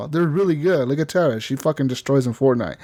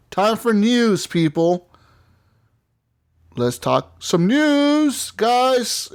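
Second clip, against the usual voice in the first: high, drawn-out segment intro; news segment call-out; 9 semitones higher, much slower than usual. Pretend. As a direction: announce it in a playful high sing-out. Hosts announcing the news segment.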